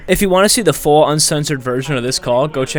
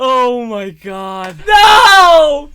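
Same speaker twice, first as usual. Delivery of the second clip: high, loud laughing cry; laughing shout; 10 semitones higher, 8 dB louder, much slower than usual. Real, not acted. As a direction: yell through the laughter. Laughing at the prank.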